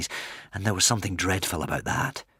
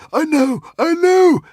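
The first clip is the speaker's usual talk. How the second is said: very high, louder squeak; tiny frantic squeaking creature; squeaky little dragon; 8 dB louder than usual. A narrator's reading. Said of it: "squeaked the Woden's Fang".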